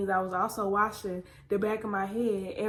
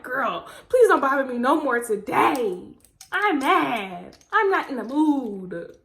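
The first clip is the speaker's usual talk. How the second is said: louder, fed-up refusal; irritated brush-off; 2 semitones higher, 6 dB louder, slower than usual. Retold or imitated. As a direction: tell mom off, fed up. Mad at mom.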